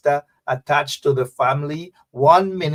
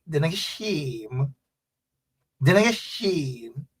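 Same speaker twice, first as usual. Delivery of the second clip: softer, lilting Guyanese country twang; rhythmic Corentyne twang; 3 semitones lower, 6 dB quieter than usual. Shown as a demonstration. Telling viewers to say it to neighbors, rhythmic Guyanese twang. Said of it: "You say it with kinda like a nice rhythm".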